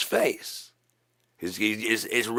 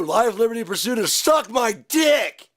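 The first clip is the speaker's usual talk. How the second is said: angry shout; 10 semitones higher than usual. Acted. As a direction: bellow it like a wild patriot. Mock-patriot rant, shouting.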